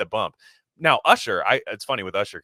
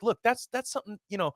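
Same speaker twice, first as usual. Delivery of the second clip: much higher, lightly put-on smooth voice; 7 semitones higher, 3 dB quieter, faster than usual. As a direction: play the celebrity giving a careful answer, pitched up. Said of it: "because he's like".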